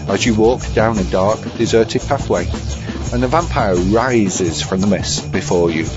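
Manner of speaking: normal talking voice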